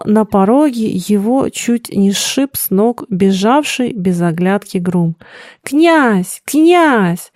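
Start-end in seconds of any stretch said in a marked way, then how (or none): 5.6-7.3 high, stretched cry for help; bewildered calling. A narrator's reading. The frightened groom calls for the prince, bewildered. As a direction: call out twice, rising in dismay. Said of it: "звал он растерянно".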